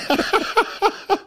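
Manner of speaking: squeaky high burst of laughter. Real, not acted. Laugh after joke. (hohes, quietschendes Lachen)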